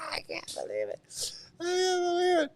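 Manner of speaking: faint, squeezed gasp after laughing; breathless from laughing. Real, not acted. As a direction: barely get it out, spent from laughter.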